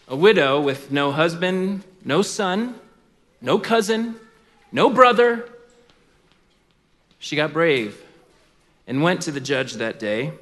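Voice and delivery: ordinary delivery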